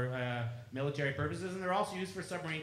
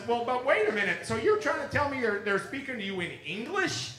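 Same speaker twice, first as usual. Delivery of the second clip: disbelieving question; 9 semitones higher, 5 dB louder than usual. Retold or imitated. Retold disbelieving question to April.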